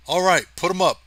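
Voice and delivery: harsh cry. A narrator's reading. A stranger over the radio orders them to surrender, harsh cry. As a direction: bark the order like a captor. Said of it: "a new harsh voice cried".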